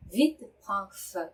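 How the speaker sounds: careful, slow falling French; slow model reading. Shown as a demonstration. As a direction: lay each sound down for learners. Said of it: "slow model reading of French".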